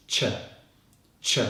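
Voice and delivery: isolated "Ch" bursts, tongue behind top teeth; strong CH. Shown as a demonstration. Teacher showing how to make the sound, strong "Ch". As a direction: release a short, sharp "Ch", twice. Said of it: "To make this sound".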